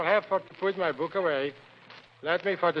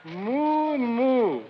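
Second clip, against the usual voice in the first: long, high mooing; cow moo; 6 semitones higher than usual. Acted. Reading a farm primer aloud, imitating a cow's moo. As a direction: moo it out slowly, pitched up. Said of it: "and the cow says".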